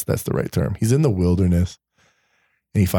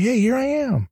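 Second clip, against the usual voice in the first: tender God. Retold or imitated. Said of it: "That's all he wants".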